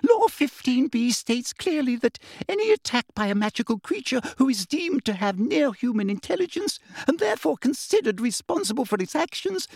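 high-pitched, officious recital of law; squeaky bureaucrat holding forth; frightened official. A narrator's reading. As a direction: quote regulations in a high, scared voice. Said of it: "Still pointing her shaking wand".

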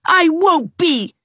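put-on high angry boy's yell; furious shout. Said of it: "shouted Gerald furiously".

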